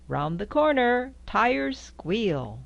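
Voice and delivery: ordinary delivery, nothing marked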